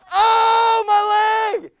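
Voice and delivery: high, loud mock yell "Oh, my leg!"; loud staged cry of pain; mock scream of pain. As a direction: ham up the shock of a broken leg. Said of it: "you're screaming".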